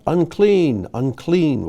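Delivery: calling out. Acted. Teacher acting a leper going about, calling out. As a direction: call it out like a leper announcing himself. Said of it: "calling out".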